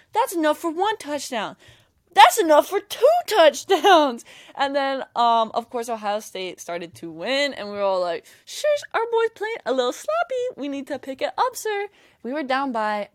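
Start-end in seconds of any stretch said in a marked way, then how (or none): 0.1-1.6 higher-pitched imitation of a hyped fan; confident fan boast. Imitates fan's confident countdown boast. 2.1-4.3 TWO touchdowns yelled high and drawn out; escalating fan yell. Retold or imitated. Fan's boast escalates to a yell. 8.4-11.9 high-pitched mock scolding of the team; mock fussing. Mimics fans' fussing at their team.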